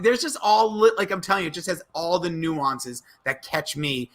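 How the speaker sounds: normal talking voice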